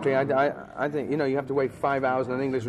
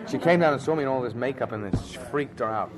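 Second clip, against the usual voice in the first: ordinary delivery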